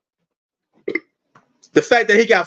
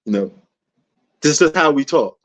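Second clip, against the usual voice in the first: ordinary talk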